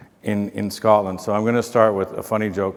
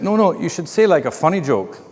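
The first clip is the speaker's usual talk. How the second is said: high, lilting imitation Scottish; bad Scottish accent; 6 semitones higher than usual. Hotel worker advising the speaker in the elevator, bad Scottish accent. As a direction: put on a sing-song Scottish voice. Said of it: "bad accent, I know".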